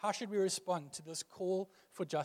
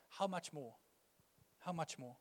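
hushed refrain; 2 semitones lower, 11 dB quieter than usual. Real, not acted. Preacher repeating his point to the congregation, hushed. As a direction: drop right down and let it sink in.

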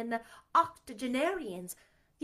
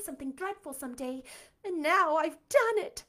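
higher, louder, near tears; distraught; 9 semitones higher, 9 dB louder than usual. Acted. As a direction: wail it in frantic despair. Anne despairing after mistake.

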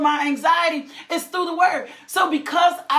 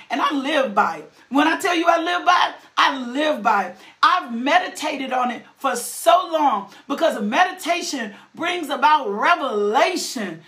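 usual speaking voice

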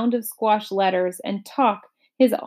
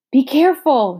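higher and louder call; boy's alarmed shout; shouted warning; 6 semitones higher, 5 dB louder than usual. A narrator's reading. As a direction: warn him urgently. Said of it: "shouted Milo".

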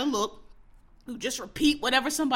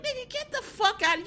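incredulous outburst; 12 semitones higher than usual. Real, not acted. Exasperated outburst at Comey's book.

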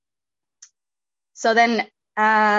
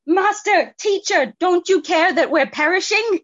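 high, loud plea of alarm; panicking; 10 semitones higher, 6 dB louder than usual. Acted. Disciples waking Jesus in the storm, panicked plea. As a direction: cry out in acted panic, pitched well up. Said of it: "they're panicking and they say".

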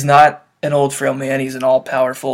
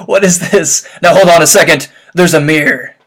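excited outburst; 11 dB louder than usual. Real, not acted. Listener bursting out as the mirror appears, loud and excited. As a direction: burst out at the twist.

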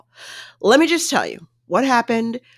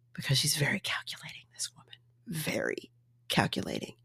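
mock gossip whisper; 4 semitones lower, 13 dB quieter than usual. Friends gossiping about Zeneb, a conspiratorial whisper. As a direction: hush it like juicy gossip.

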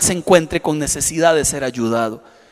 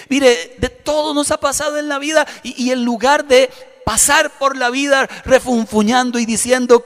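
raised, fervent preaching voice; rally the congregation toward serving God; preaching build; 5 semitones higher than usual. Acted. Pastor preaching to his church, raised and fervent.

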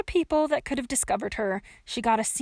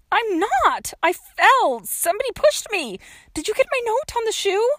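put-on high, loud young girl's voice; frantic protest; 11 semitones higher, 6 dB louder than usual. A narrator's reading. Trapped girl defends herself to the woman above, loud and high. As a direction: protest urgently, calling up.

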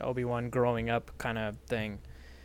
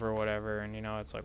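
usual speaking voice

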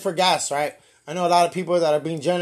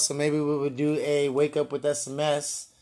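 usual speaking voice

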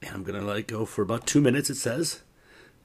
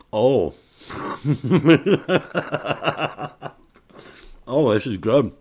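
delighted; 4 semitones higher, 6 dB louder than usual. Real, not acted. First bite of the waffle.

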